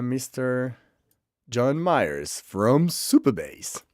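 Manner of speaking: very slow announcer intro, swooping on the name; grand, stretched-out guest billing; showy name announcement. Real, not acted. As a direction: draw out every word of the introduction. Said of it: "why do I say it like that".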